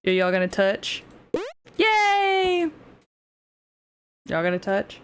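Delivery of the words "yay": ordinary talk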